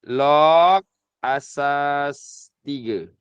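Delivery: log asas tiga, each word drawn out very slowly; slow read-aloud. Shown as a demonstration. Teacher to students showing how to read it, very slow and deliberate. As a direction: spell out the reading slowly for the class. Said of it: "Sebutan dia".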